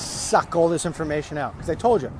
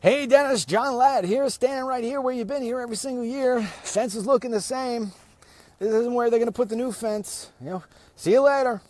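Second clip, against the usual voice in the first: chirpy retold phone message, pitched up; imitating John's video; 9 semitones higher than usual. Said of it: "He goes with his cell phone".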